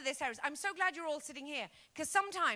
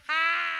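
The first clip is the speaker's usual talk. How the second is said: loud scornful ha; sharp mock laugh; 3 semitones higher, 10 dB louder than usual. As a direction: laugh at yourself as your brain.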